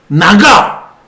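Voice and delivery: loud sharp order; command. Retold or imitated. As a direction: shout it out. Pastor commanding demon out.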